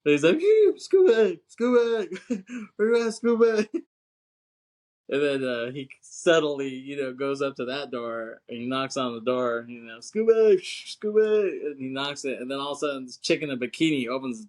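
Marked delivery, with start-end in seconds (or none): 0.4-3.7 high, loud drawn-out calls; mock dog-calling. Busey asking where his dog is, drawn-out calling. 10.0-11.7 high-pitched calling out; mock dog-calling. Busey knocking on the door, calling for his dog.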